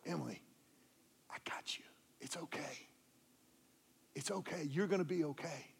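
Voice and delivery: hushed, tender near-whisper; whispered comfort. Retold or imitated. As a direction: murmur to a crying child. Holding sobbing daughter at night.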